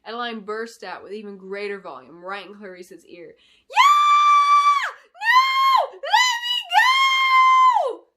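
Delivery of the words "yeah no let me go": high piercing screams; a little girl thrashing and shrieking; loud high desperate screams; screaming girl. Acted. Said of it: "the little girl screamed".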